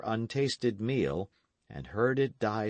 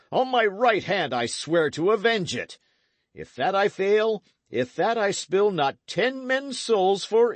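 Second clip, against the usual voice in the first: loud, higher, furious vow; raging oath; 7 semitones higher, 6 dB louder than usual. Acted. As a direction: knight swearing vengeance in rage and grief. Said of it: "convulsed with rage and grief".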